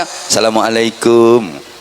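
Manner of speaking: low, slow, gentle salam at a neighbour's door; polite house-visit greeting. Shown as a demonstration. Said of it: "Kalau namu ke rumah ya".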